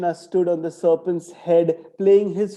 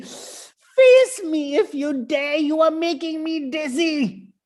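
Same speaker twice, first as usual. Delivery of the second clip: high, slow, loud snake voice, dizzy and furious; furious serpent demanding Krishna stop; angry snake; 11 semitones higher, 4 dB louder than usual. Acted. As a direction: bellow like a giddy serpent.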